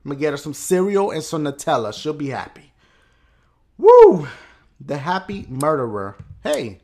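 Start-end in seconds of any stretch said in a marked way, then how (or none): 3.8-4.3 high, loud "woo"; excited whoop. Real, not acted. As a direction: let out a celebratory whoop. Whoop while playing.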